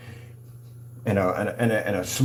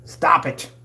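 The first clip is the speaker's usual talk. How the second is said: sharp, higher, punched-up "Stop it"; fed-up outburst, raised pitch; exasperated command; 6 semitones higher, 4 dB louder than usual. Real, not acted. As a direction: scold them with a forceful "Stop it". Scolding rivals, repeats it forcefully.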